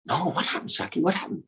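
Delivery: soft, high curious voice; eager gopis. Acted. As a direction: beg your friend to tell, high and hushed. Eager gopis pressing for the secret.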